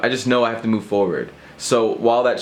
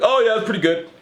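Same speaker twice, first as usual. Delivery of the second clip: vague bluff; 11 semitones higher than usual. Retold or imitated.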